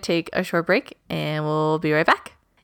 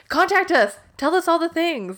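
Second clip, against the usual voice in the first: excited; 10 semitones higher than usual. Retold or imitated. Imitating eager reviewer.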